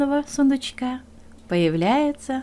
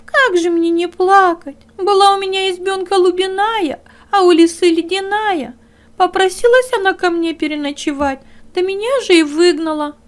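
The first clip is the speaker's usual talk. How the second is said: high, louder tearful little hare voice; weeping hare; 8 semitones higher, 7 dB louder than usual. Acted. Hare complains to the dog, high and tearful. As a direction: whimper your woe like a small hare.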